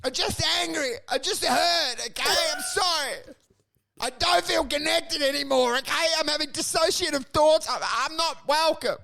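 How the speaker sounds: high-pitched, whining apologies; hammed-up wounded self-justifying; acted pleading. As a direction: plead pitifully with an attacker. Beaten man keeps begging old man.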